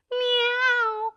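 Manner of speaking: very high alarmed meow; a panicked cat yowl. A narrator's reading. Wilbur racing for the door, very high alarmed meow. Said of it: "went Wilbur".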